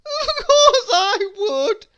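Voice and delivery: high, loud, slow crying. A narrator's reading. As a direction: drag it out through tears. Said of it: "cried the king".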